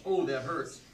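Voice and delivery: normal talking voice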